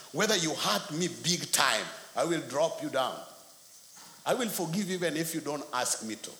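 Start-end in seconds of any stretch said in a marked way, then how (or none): none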